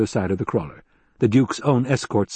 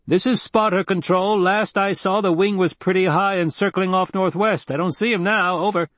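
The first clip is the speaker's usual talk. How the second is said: lightly acted radio operator, pitched up; spotter's radio report; 9 semitones higher than usual. Spotter reporting to control on the missing wing, businesslike radio voice. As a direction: report in a higher character voice.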